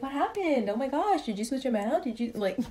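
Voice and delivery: high mock-shocked husband, grinning; husband smiling while faking alarm; teasing husband. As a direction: echo her panic to tease her. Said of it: "he's smiling and he's like".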